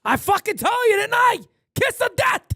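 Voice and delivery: loud, high triumphant yelling; excited shouting. Real, not acted. Gamer yelling at the screen in triumph, loud excited shouts. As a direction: crow over the play you called. Celebrating a game play.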